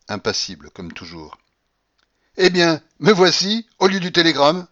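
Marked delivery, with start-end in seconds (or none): 2.4-4.7 loud, high, imperious grandmother voice; commanding babouchka who bursts in unannounced; old grandmother. A narrator's reading. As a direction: announce herself like a triumphant old matriarch. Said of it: "dit enfin la babouchka".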